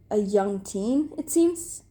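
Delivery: ordinary delivery, nothing marked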